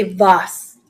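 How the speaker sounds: vase rhyming with floss; vase as VAHSS. Shown as a demonstration. Asking viewers which way they say it, vase as VAHSS. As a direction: say vase with an open AH sound. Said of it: "or do you say vase".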